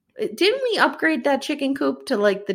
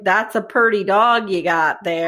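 put-on creepy Southern drawl; low-key Southern menace; menacing Southern accent; 4 semitones lower than usual. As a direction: play a sly Southern dognapper. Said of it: "They didn't have Southern accents".

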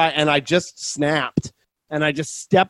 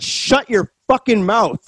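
slow, higher, half-laughing shut your fucking mouth; retold insult; 7 semitones higher, slower than usual. Telling off the yelling lady, slow retold snap. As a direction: spit it out slowly, amused at yourself. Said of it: "I said".

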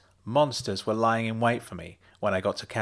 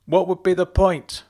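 shouted; 7 semitones higher, 7 dB louder than usual. A narrator's reading. Husband keeps shouting back.

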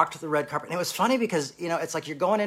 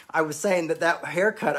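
normal talking voice

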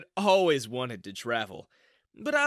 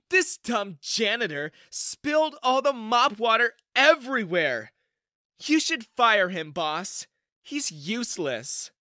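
higher, louder snide accusation; spiteful; 8 semitones higher, 5 dB louder than usual. Acted. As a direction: sneer it to the boss. Manager blames janitor to CEO.

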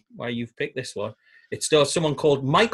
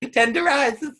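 camp voice; 9 semitones higher, slower than usual. Acted. Echoing the camp voice.

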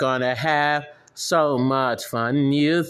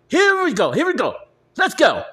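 high excited hype call; rally the crowd; 10 semitones higher, much faster than usual. Acted. Host firing up listeners before the chant. Hyping up.

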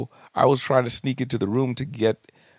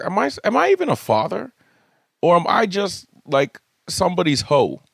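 much higher, half-joking incredulous whine; mock disbelief; 8 semitones higher than usual. Dad asks himself if he is just a milk giver, mock incredulous. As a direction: play up the outrage of a put-upon dad. Dad's incredulous inner reaction.

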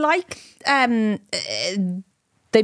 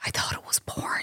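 hushed, voiceless whisper; barely audible, all breath; whispered confession; 11 dB quieter than usual. Retold or imitated. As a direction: whisper it like a scandalous admission. Confessing the mistaken fear.

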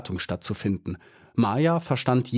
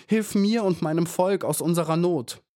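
much higher, pleading harder; rising desperate plea; 8 semitones higher than usual. Acted. Maya begging God to save her people, higher pleading. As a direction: repeat the plea with more urgency. Repeated plea, more urgent.

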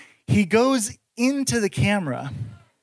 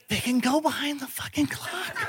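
hushed disbelief; 2 semitones higher, 9 dB quieter than usual. Retold or imitated. Roach hides behind clock.

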